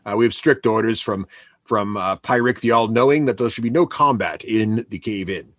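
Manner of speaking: low-pitched, hesitant goblin official; apologetic goblin guard. Acted. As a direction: politely lay down the rules, voice dropped low. Goblin enforces the no-combat rule.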